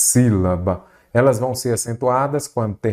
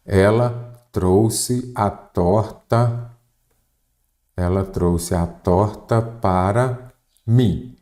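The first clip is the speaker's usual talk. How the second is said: very slow, with gaps between words; slow dictation; much slower than usual. Shown as a demonstration. Teacher writing an example for the class, slow dictation. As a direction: spell the sentence out word by word.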